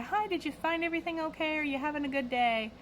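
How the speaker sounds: high, quick customer-service voice; put-on pleasant retail singsong; chirpy cashier greeting. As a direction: greet the next customer with forced cheer. Cashier greeting next customers as if fine.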